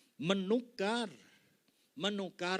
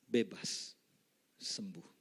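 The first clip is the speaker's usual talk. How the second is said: normal talking voice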